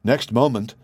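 ordinary delivery

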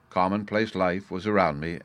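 usual speaking voice

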